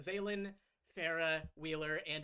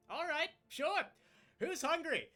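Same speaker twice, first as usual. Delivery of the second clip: higher, louder cheerful call to the guests; bright hostess call; 10 semitones higher, 7 dB louder than usual. Acted. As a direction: perk up and play the eager hostess. Hostess brightly offers food.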